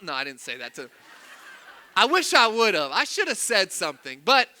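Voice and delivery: ordinary talk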